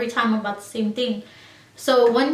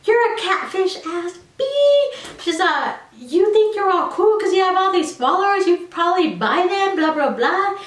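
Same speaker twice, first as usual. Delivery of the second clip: insulting classmate; 8 semitones higher than usual. Retold or imitated. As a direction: hurl the insults at her. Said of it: "She is coming for me".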